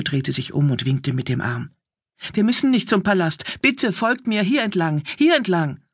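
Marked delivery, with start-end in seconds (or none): 2.3-5.8 put-on higher-pitched, insistent young messenger; urgent urging. A narrator's reading. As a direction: urge them to follow, pitch raised. Messenger urges the couple onward. (drängend, mit höherer Stimme)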